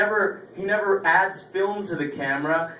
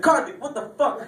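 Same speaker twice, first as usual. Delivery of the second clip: shocked outburst; 8 semitones higher than usual. Pretend. Isaac catches them.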